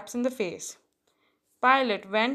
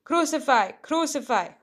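higher, louder crowd cry, kept in check; narrated frenzied shout; 5 semitones higher, 4 dB louder than usual. A narrator's reading. High priests and police demand death on seeing him, restrained shout. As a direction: read it as a mob's chant, moderately raised. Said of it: "they shouted in a frenzy".

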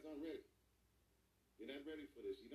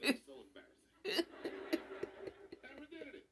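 laughing embarrassment; 9 semitones higher, 9 dB louder than usual. Real, not acted. Laughing about the town meeting.

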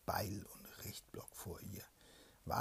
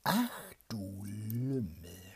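slow, louder whispered scold; mock scolding shout; 10 dB louder, much slower than usual. Princess scolding the soldier in the cage, slow loud whisper. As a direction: drag out the rebuke. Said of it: "schrie Jelena die Weise".

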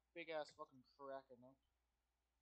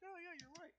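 acted quote; 11 semitones higher than usual. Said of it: "He's like".

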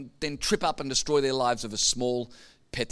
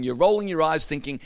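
ordinary delivery

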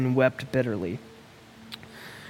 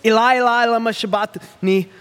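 high, loud anguished cry out; a dying man crying out loud; 6 semitones higher, 4 dB louder than usual. Acted. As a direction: cry it out to heaven. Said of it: "Jesus cried out in a loud voice".

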